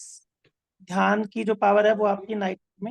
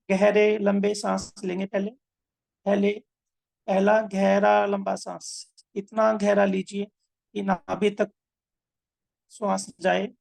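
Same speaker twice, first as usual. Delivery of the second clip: measured meditation guide with long pauses; unhurried breathing-exercise instruction; guided meditation. Acted. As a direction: guide them breath by breath, drawing out key words.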